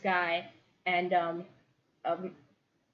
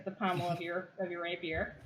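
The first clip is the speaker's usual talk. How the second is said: ordinary delivery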